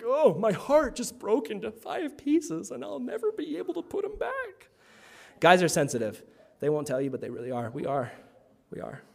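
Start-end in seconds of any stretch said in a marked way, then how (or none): 0.0-4.7 mock heartbroken. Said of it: "You're like".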